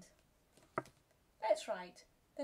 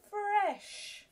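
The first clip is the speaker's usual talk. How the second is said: bright pitched read-back; 11 semitones higher than usual. Shown as a demonstration. Teacher checks spelling, lifting fresh high. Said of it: "Let's write them".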